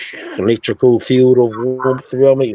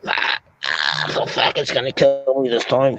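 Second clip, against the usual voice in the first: choked panic; 2 semitones higher than usual. Retold or imitated. Choking, fearing death.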